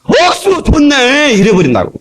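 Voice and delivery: a hint of a Gyeongsang drawl, slow and high; imitating a Gyeongsang local; a mild mock Gyeongsang cry of delight; Gyeongsang dialect. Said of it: "경상도".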